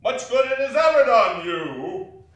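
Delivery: bellowing, exasperated miser; furious shout. Acted. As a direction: roar back at the nephew. Scrooge shouts back at nephew.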